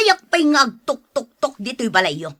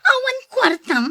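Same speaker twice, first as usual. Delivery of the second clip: high, sharp disbelieving cry; can't believe he's broke; shocked disbelief; 13 semitones higher than usual. Acted. Wife stunned husband has no money, shocked high cry.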